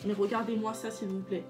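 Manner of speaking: ordinary delivery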